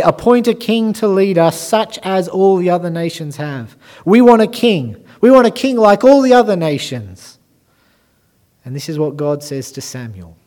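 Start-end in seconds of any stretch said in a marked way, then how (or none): 0.0-7.4 high, loud demanding plea; crying out. Acted. Israel crying out to Samuel for a king, loud and high. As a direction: clamour for it like a restless nation. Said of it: "they cry out to Samuel".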